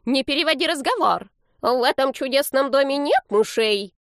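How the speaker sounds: put-on high, emphatic old-lady scolding; indignant grandma putting him straight, high; angry granny. A narrator's reading. Elderly woman tells grandson off, high angry voice. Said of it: "рассердилась пожилая женщина".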